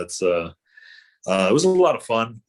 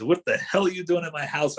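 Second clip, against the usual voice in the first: mock bum yell; 7 semitones higher than usual. Bum bursting from the boxes at Zach, mild mock yell. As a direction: replay the bum jumping out of the box, light yell. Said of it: "all I got out was".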